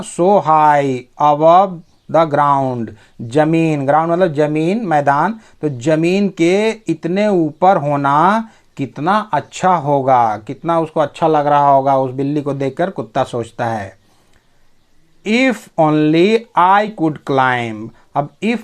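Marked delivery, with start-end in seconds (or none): 0.0-2.9 very slow, word-by-word English; slow dictation pace; slow reading. Shown as a demonstration. Teacher reads story line aloud. 15.3-17.9 very slow, word-by-word English; slow dictation pace; slow reading. Shown as a demonstration. Teacher reads story line aloud.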